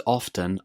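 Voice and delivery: often as "OFF-ten", with a T; T pronounced in OFTEN. Shown as a demonstration. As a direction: sound the T in often. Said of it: "both pronunciations".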